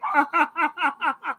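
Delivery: high rapid giggle; real high-pitched laughing. Real, not acted. Streamer amused by his frogs joke, high laugh.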